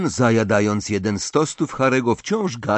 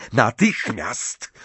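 drawn-out, higher, insistent order; huffy command; 5 semitones higher, much slower than usual. A narrator's reading. Mother ordering Ginny downstairs, snapping crossly. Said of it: "fuknęła pani Weasley".

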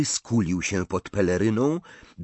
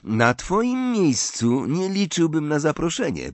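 Zabini; 8 semitones higher, 3 dB louder than usual. A narrator's reading. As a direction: cut in on the boaster in a raised pitch. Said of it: "powiedział Zabini".

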